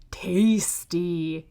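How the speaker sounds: unvoiced gloating whisper. Wolf after eating Granny, relishing whisper. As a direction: breathe it with relish.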